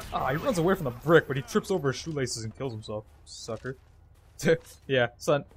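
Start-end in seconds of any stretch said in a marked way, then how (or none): none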